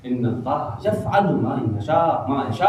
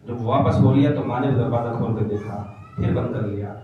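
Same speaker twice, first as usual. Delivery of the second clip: normal talking voice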